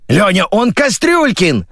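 high-pitched, drawn-out alarm; panicking. A narrator's reading. Editor corrects the radio voice, panicking. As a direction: plead in a flustered high voice. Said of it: "запаниковала редактор".

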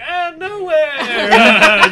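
laughing dodge. Retold or imitated. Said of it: "I'm like".

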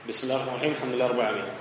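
soft, quietly recited Fatiha opening; Quran recitation. Shown as a demonstration. Teacher restarting the Fatiha to show it, softly recited. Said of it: "أروح أجيب الفاتحة من جديد".

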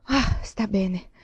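slow, sighing relief; relieved sigh. A narrator's reading. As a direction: breathe the words out, relieved. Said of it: "Lei sospirò, sollevata". (sospiro lento di sollievo)

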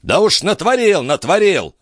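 put-on high-pitched irate old baron; exasperated high-voiced outburst; angry roar. A narrator's reading. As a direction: roar it back, high and indignant. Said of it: "проревел барон".

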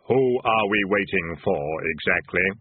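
low, slow, hollow Death voice; grim reaper voice. A narrator's reading. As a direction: speak slow and deep as Death. Death speaks in story.

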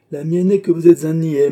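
loud, high-pitched outburst; exasperated insult. Acted. Father calling his son a fool, exasperated.

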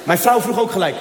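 ordinary talk, nothing marked

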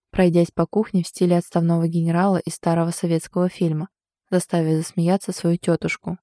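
usual speaking voice

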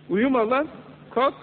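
half-playful "get up" yell; mock wake-up shout. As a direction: jolt a sleeper awake. Preacher voices wake-up call.

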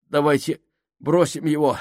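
out of breath, blurted; gasping it out fast; urgent panting report. A narrator's reading. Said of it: "задыхаясь, выпалил Минхаус". (задыхаясь, выпаливая)

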